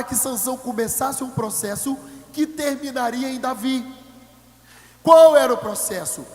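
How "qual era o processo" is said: loud rhetorical question; preaching shout. Real, not acted. Preacher challenging the congregation, loud question. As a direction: call the question out to the room. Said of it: "rhetorical question to congregation".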